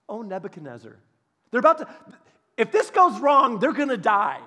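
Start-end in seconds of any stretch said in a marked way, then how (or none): 0.1-1.0 soft, calm "O Nebuchadnezzar" with a falling end; "O Nebuchadnezzar" said softly, with no heat; quiet respectful address. Shown as a demonstration. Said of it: "Oh, that didn't work".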